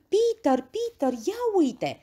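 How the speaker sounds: high, loud startled call; frightened cry. A narrator's reading. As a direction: cry out in alarm to Peter. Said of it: "a strigat speriată Heidi".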